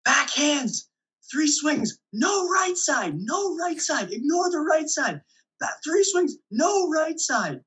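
high-pitched mock yell, drawn-out calls; imitated yelling. Opposing player calling out hitters at the net, yelling. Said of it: "this guy's right in his face yelling".

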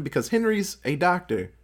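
normal talking voice

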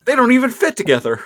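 high, fast exclaim; excited. Real, not acted. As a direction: blurt it out high and quick. Mock outraged commentary.

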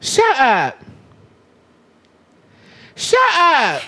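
high, drawn-out shut up; stunned disbelief. Real, not acted. Host hearing cohost's surprise fact, high disbelief. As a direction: react in shocked disbelief.

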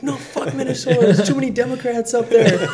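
high, laughing mock shout; high put-on rant, laughing through it; imitated angry yelling caller. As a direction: yell it as the ranting caller, cracking up. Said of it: "he basically was screaming at me".

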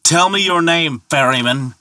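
high, shouted demand; shouting. Acted. Thor demanding the ferryman's name, shouting. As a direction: shout the order across the sound. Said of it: "shouted Thor".

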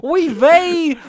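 high, loud laughing oy vey; hammy Yiddish-accented oy vey. Pretend.

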